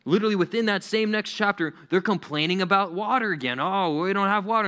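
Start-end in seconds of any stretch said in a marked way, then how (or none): 3.6-4.7 high-pitched imitation of a whine; mock grumbling. Israelites to Moses about water, mock grumbling. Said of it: "and they're grumbling".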